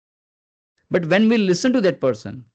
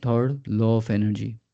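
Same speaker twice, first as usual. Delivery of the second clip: low, lifeless monotone; monotonous; 6 semitones lower than usual. Shown as a demonstration. Trainer shows online class a boring monotonous delivery. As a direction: drone "Third, law of energy" low with no rise or fall. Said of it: "What if I am speaking like this?".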